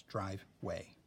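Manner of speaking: "drive, way" as two words with a pause; broken into syllables. Shown as a demonstration. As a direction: split "drive, way" at the dash. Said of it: "where the syllable is".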